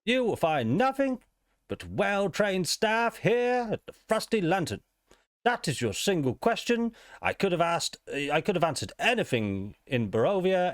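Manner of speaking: a stiff, high-pitched card dealer; dealer character. Acted. Game master voicing the dealer to the player, high and formal.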